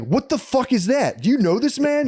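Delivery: hammed-up furious father; outraged dad. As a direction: play the shocked, angry dad demanding answers. Angry dad reacting to buzzer.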